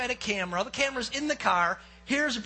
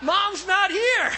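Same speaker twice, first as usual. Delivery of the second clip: loud, high, drawn-out retort; defiant shout; 9 semitones higher, 10 dB louder, much slower than usual. Retold or imitated. Young hunter brushing off the warning, retold defiant shout. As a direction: belt out the cheeky comeback.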